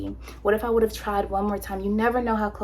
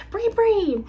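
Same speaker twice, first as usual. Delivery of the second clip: much higher sing-song call; high, bright mock call; 13 semitones higher, 5 dB louder than usual. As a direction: call your sister across the room. Calling sister by nickname.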